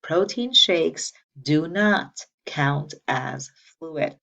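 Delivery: very slow, loud, word by word; emphatic teacher's drill at half speed; say-it-with-me recitation. Shown as a demonstration. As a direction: lead the class in chanting it, each word separate. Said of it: "Say it with me".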